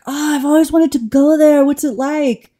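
mock an excited visitor asking about home; imitating a keen tourist; gushing tourist. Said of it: "when people say, like".

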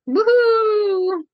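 excited cheer. Real, not acted. Cheering the new Doctor.

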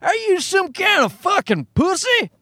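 high, incredulous jeering caricature; mocking pickup-truck guy. Pickup-truck guy taunting a vegan, high mocking jeer. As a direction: squeal the taunt as an over-the-top truck bro. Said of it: "people who drive pickup trucks and wear".

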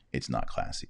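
very soft, breathy near-whisper repeat; muttered aside. Real, not acted. Host repeating his verdict to viewers, muttered quietly. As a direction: drop it to an under-the-breath mutter.

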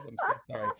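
ordinary delivery

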